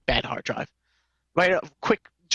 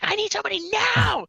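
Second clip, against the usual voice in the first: pitched way up, insistent mock voice; imitating demanding client. Mocking the client demanding help right now, high and insistent. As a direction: squeak out the client's impatient demand. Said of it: "She's, she's like".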